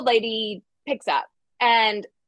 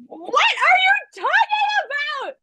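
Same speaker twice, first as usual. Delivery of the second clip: panicked shout; slower than usual. Retold or imitated. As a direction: shriek it in frightened disbelief. Panicked reply to boyfriend.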